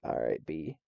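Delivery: low, quiet dismissive aside; unimpressed dismissal. Retold or imitated. Brushing off the merch-crazed kids, unimpressed.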